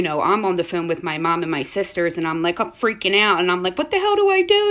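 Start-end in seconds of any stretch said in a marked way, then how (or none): 3.8-4.6 panicked shout. Retold or imitated. Retelling her own panic.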